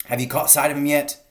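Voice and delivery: loud, high call over the noise; calling back. A narrator's reading. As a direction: call out across the field. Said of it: "Bean called back".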